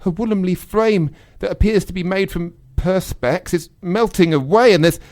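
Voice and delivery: frantic, losing control; panicked. Acted. Panicked scene, melting robot.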